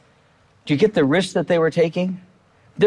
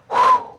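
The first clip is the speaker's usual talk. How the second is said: relieved whew. Acted. Relieved after a close call.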